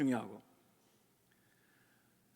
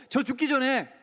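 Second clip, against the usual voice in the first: bold demand; 5 semitones higher than usual. Shown as a demonstration. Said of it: "얼마나 담대하게".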